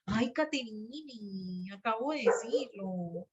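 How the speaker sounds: slow, soft, fondly fed-up "Ay, Caterine"; exasperated sigh. Real, not acted. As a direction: drag it out like a tired teacher. Teacher exasperated at repeated question. (suspiro exasperado y lento)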